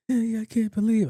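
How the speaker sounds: high-pitched mock tearful wail; imitated crying. Friend calls him at work, mock crying. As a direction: imitate a friend sobbing down the phone. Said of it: "Called me crying".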